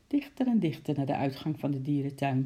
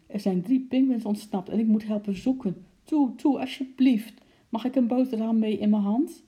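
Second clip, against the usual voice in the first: high, louder eager begging; pleading child; 7 semitones higher, 6 dB louder than usual. A narrator's reading. Girl begging mother to go help search, eager loud pleading. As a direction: beg mum urgently to let her go.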